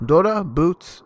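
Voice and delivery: ordinary delivery, nothing marked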